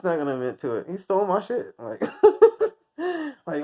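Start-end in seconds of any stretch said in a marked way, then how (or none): 2.1-2.7 sudden loud high laugh. Real, not acted. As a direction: burst into laughter. Laughing hard.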